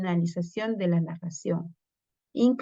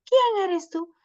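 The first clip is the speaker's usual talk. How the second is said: much higher storybook question; voiced little turtle asking; 12 semitones higher than usual. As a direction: ask shyly who is there. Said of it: "Primero tiene que preguntar".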